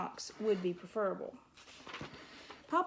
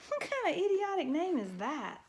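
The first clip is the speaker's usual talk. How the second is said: pitched higher, mocking disbelief; scoffing; 8 semitones higher than usual. A narrator's reading. Porcupine ridiculing the mouse's name, scoffing.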